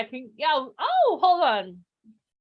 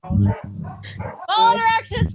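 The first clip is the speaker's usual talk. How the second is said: stern direction; 10 semitones higher, 6 dB louder, much slower than usual. Real, not acted. Hosting a group call, calling order.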